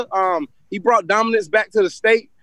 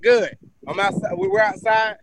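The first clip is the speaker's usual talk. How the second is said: out of breath from basketball; breathing hard between words; heavy breathing. Real, not acted. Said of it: "y'all gotta excuse the breathing hard".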